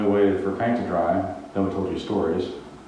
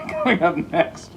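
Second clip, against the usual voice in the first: higher, slow laughing promo voice; mock announcer laughing; 8 semitones higher than usual. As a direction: tease like a TV promo.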